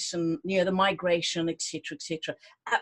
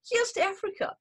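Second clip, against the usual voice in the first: raising a toast; 9 semitones higher than usual. Acted. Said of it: "I just need a toast".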